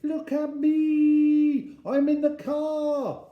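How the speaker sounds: put-on high, drawn-out child's shout; calling out. A narrator's reading. Three-year-old calling from the car window, loud high calling. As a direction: holler it slowly at a very high pitch. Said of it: "looked out the window and called".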